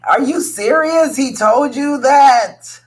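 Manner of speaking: high, loud incredulous outburst; pissed-off disbelief. Acted. Reacting to what a partner said about the mortgage, outraged disbelief. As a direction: draw it out in indignant, high-pitched outrage. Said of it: "I know you were pissed off".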